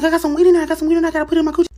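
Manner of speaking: very high, loud and racing; frantic panic. Acted. Confessing hidden weed as cop walks up, frantic shout. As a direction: gabble it out at full panic volume.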